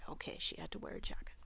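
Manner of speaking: soft whispered confirmation; whispering. Real, not acted. Reader confirms child's answer.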